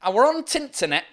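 Yorkshire dialect, "the" merged into "Tinternet"; attempted Yorkshire twang; Yorkshire accent. Shown as a demonstration. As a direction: say it in broad Yorkshire. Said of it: "try to do a Yorkshire accent".

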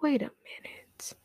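whispered, hushed disbelief; stunned whisper. Retold or imitated. Checking the pending package status, stunned whisper.

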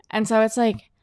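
normal talking voice

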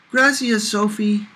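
put-on high, eager little-boy voice; boy thanking Sophie, high and eager; wound-up kid. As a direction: bubble it out, still buzzing from play. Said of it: "he said, still wound up".

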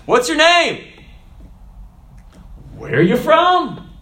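loud, high-pitched demand; forceful questioning. Shown as a demonstration. Preacher demonstrating the questions to ask, forceful questioning.

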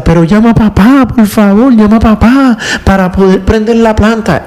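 put-on father retelling his daughter's plea in a light child voice; pleading little girl.

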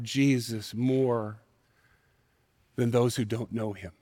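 soft, slow, low preaching; quiet sermon close. Acted. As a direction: bring it home quietly and slowly. Preaching the point softly.

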